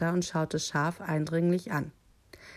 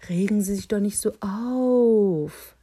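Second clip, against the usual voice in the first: raised, louder wolfish coaxing with a lisp; lisped soothing; 4 semitones higher, 7 dB louder than usual. A narrator's reading. Wolf placates the protesting sheep with a lisping coax. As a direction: lisp it, stretching high into the last word. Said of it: "lispelte der Wolf".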